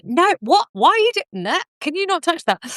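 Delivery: high, exasperated bickering; mock exasperated squabble. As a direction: play the annoyed spouse in the kitchen. Acted squabble cooking with husband.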